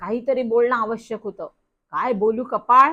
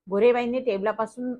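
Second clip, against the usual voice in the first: usual speaking voice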